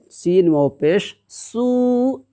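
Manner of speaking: slow, drawn recitation of letters; spelling out syllables. Shown as a demonstration. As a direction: sound out each letter slowly like a Quran teacher. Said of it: "ज़बर की आवाज़ ऊपर को जाती है".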